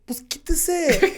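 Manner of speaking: high, loud, drawn-out retold comeback; exasperated retort. Driver telling the lady to move, exasperated. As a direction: throw it back, pitch jumping up, slowly.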